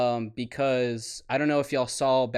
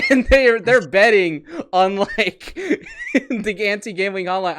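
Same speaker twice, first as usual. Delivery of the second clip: laughing; 8 semitones higher, 9 dB louder than usual. Real, not acted. Laughing while retelling funny clip.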